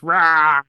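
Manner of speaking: loud imitated Wookiee roar; Chewie's roar. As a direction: let out a big mock creature roar. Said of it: "and then Chewie goes".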